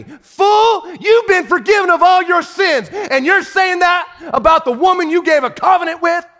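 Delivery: high, loud, driving sermon voice; preaching at full force. Acted. Pastor scolding the husbands from the pulpit, full-force preaching. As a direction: thunder it from the pulpit at the men.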